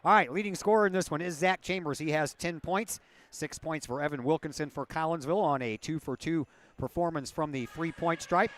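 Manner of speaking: usual speaking voice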